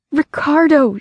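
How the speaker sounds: high cry of a name in shock. A narrator's reading. Wife crying out to her dying husband, shocked high cry. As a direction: call his name in horror. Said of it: "she cried".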